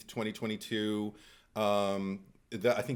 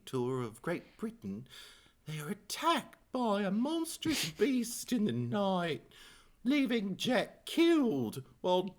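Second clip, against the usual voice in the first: Glenn voice; 9 semitones higher than usual. Acted. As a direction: read the premise as Glenn, building to the title. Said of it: "I could do it as Glenn".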